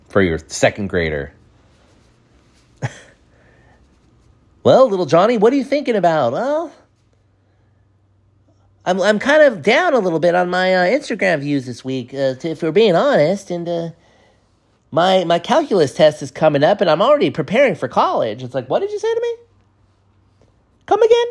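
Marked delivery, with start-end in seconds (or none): 4.6-6.3 put-on high, singsong talk to a small kid; mock kiddie-counselor voice; counselor to child. Counselor addresses a second grader. 6.3-18.4 high-pitched put-on child voice; comic second-grader, halting and high; little kid. Second grader talking to his counselor. 18.7-21.2 very high falsetto disbelief; mock-offended squeal; incredulous. Incredulous reaction to the six-year-old.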